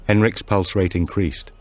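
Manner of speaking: plain reading voice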